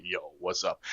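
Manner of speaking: low, soft, deep-voiced greeting; expected deep voice. Streamer imitating the voice people expect of him, deep. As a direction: drop it low, cool and casual. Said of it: "they expect me to be like".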